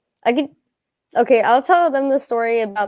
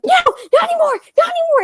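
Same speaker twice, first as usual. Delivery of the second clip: very high, fast panicky squeal; squeaky mock scream, words tumbling out; retold panicked screaming; much faster than usual. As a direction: reenact frantic begging on a coaster. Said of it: "I'm just screaming".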